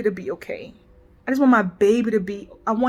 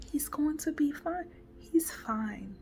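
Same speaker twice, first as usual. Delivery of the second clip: soft comforting whisper; 13 dB quieter than usual. Retold or imitated. Cantrell to the crying narrator, a soothing whisper. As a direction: whisper it gently, reassuring.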